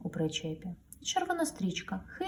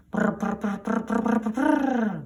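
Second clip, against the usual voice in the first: higher rotor sound from afar; distant helicopter; 5 semitones higher, 4 dB louder than usual. A narrator's reading. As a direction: imitate a far-off helicopter. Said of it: "звук чути здалеку". (віддалений гул вертольота)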